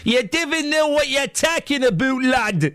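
broad, put-on Geordie; hammed-up Geordie lad; mock Geordie accent. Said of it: "when I do that accent".

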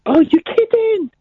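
high-pitched, badly faked camp dismay. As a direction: go high in a hammed-up camp protest.

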